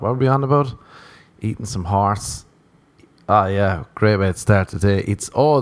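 ordinary delivery

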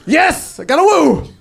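high, triumphant exclamation; excited. Real, not acted. Comic thrilled to get a woo from the crowd, excited. Celebrates a cheer from audience.

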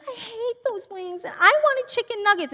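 put-on high-pitched complaining kid; protesting child. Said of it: "Other one's like".

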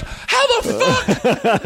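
drawn-out, sky-high disbelief; mock astonished crowd. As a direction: stretch it out as a flabbergasted audience. Audience astonished at final trick.

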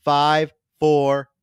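loud studio countdown call; a backstage director counting in; director's countdown. Retold or imitated. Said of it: "they never left in clips of".

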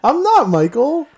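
high, slow, loud mock innocence; teasing denial. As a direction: deny it sweetly and slowly.

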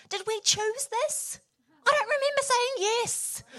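high, loud, drawn-out mock protest; hammed-up high-pitched complaint to a spouse; acted panicked wail. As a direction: wail it in exaggerated dismay. Re-enacts her panicked protest to husband.

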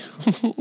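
a real burst of high laughter. Real, not acted. Laughing at the memory.

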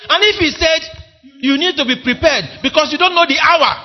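fiery sermon appeal; full-force preaching. Acted. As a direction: preach it with all your strength.

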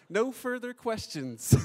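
high, loud, slow mock child voice; little daughter. Two-year-old done questioning Dad, imitated high, loud and drawn out. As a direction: declare it like a toddler closing the case. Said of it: "And she's like".

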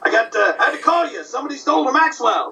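high, fast, laughing valet excuse; Rochester voice. Retold or imitated. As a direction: pipe it up high like a sheepish valet. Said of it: "doing Benny and Rochester".

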